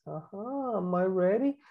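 ordinary talk

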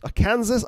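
"Arkansas" said as ar-KAN-zəs, rhyming with Kansas; fumbled "Arkansas", like "a Kansas"; mispronunciation. Real, not acted. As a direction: say "Arkansas" with a stressed KAN and a final s. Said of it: "I'm never gonna get that right".

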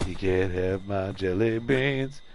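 low, drawn-out, quiet taunt; slow taunt. Pretend. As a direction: draw it out low and soft. Taunting the game's killer.

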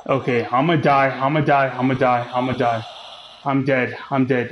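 normal talking voice

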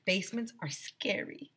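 hushed scared whisper; small spooky whisper. A narrator's reading. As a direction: breathe it like a frightened child. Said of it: "Emily said".

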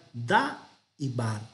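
da y bar said slowly, syllable by syllable; teacher slowly splitting da y bar; syllable split. Shown as a demonstration. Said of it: "Lo tenemos ya transliterado".

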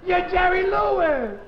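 slow, high, loud horror; horrified accusation. Acted. Husband accusing his wife of being Jerry Lewis. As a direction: point and recoil.

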